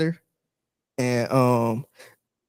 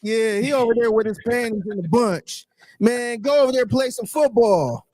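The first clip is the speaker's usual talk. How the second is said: mock caller taunt; 6 semitones higher than usual. Host mimics a caller taunting a quarterback, raised and jeering. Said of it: "That sound like some shit he would say".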